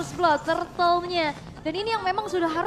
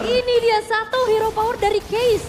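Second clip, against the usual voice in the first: higher and louder hype; excited call; 8 semitones higher, 8 dB louder than usual. Real, not acted. Co-caster hyping Case's hero power, excited call. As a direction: sell the moment to the crowd.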